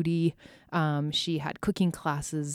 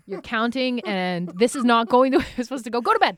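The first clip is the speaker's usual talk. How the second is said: louder, higher, building to an exasperated order; fed-up mom; 6 semitones higher, 8 dB louder than usual. Retold or imitated. Impatient mom ordering kids to bed, rising loud. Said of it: "and I'm like".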